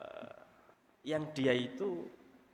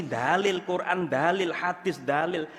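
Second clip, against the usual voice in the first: loud, hammered-out list of proofs; forceful mock sermon, each item drawn out; mock preaching; 3 semitones higher, 7 dB louder than usual. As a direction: imitate a preacher firing off scripture, loudly. Mimics himself preaching outside the home.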